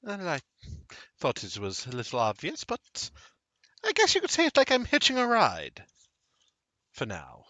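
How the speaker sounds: lilting character voice, leaping high; coy mysterious woman. Acted. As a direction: play it coy with a rising pitch.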